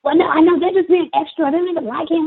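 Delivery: squeaky high mock insistence; dismissive teacher's assistant. Assistant answering that it is their peer, dismissive high voice. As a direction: imitate her overriding the objection, very high. Mimics assistant overriding the speaker.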